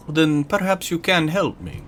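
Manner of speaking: ordinary talk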